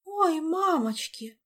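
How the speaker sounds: high, slow, drawn-out dismay; shocked wail. Acted. Olga seeing what became of Diego, high dismayed exclamation. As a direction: let out an appalled little wail.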